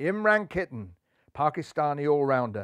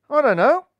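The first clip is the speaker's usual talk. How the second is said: high, loud musing voice; pondering; 10 semitones higher, 11 dB louder than usual. A narrator's reading. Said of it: "pondered Buntham".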